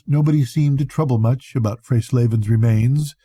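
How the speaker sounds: ordinary delivery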